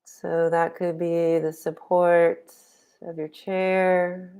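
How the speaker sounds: unhurried guiding voice with long pauses; guided meditation. Real, not acted. Meditation teacher settling online group into posture and breath, guided meditation. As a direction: ease them into stillness, phrase by phrase.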